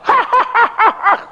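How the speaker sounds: high staccato demonic cackle; possessed laugh. Retold or imitated. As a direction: cackle suddenly like a possessed man. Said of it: "dari nangis dia ketawa". (tawa setan melengking terputus-putus)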